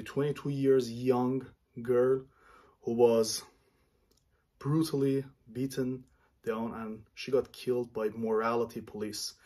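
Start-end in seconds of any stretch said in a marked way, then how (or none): none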